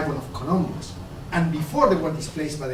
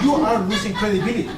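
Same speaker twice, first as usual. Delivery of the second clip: laughing; 5 semitones higher, 5 dB louder than usual. Real, not acted. God scolds two bickering actors.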